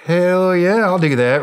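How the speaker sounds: high, loud mock-eager cheer from the soldiers; eager troops. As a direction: play a pumped-up soldier who can't wait. Troops eager, retold reaction.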